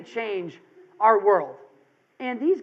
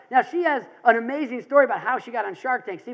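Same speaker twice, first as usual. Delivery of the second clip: usual speaking voice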